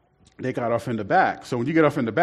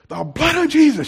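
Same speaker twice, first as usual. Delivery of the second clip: higher, louder rebuke; commanding rebuke; 12 semitones higher, 4 dB louder than usual. Retold or imitated. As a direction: rebuke the gang with force. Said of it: "Sister Jones said".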